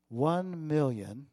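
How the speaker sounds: drawn-out dramatic pause on "One million"; slow reveal. Acted. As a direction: let "One million" hang, slowly, for suspense. Announcing the total to the congregation.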